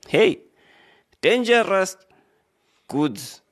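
high, loud mock alarm on dangerous; hey, dangerous goods with theatrical shock. Real, not acted. Reacting to headline phrase.

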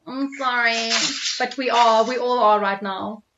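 boxed-in room sound; speaking from a closet; small room. Real, not acted. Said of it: "You sound like you're in a closet or some confined space".

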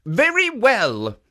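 a lightly voiced kindly guardian; kindly statue voice. Jitso speaks.

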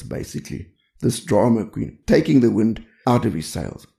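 ordinary talk

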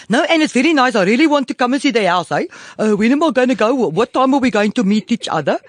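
high, loud South African caricature; hammed-up South African house-buyer; South African accent. Guest mimics a client wanting a viewing, South African accent. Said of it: "I like to just take off South Africans".

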